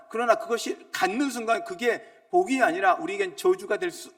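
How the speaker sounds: loud, high, insistent sermon cry; fervent preacher proclaiming; preaching at full force. Acted. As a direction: drive it home to the congregation.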